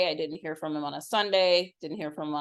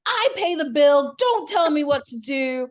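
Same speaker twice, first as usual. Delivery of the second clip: raised, loud and higher, scolding hard; angry; 9 semitones higher, 8 dB louder than usual. Retold or imitated. Mother scolding her son who told her to text, angry and loud. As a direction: put him in his place, sharp and loud.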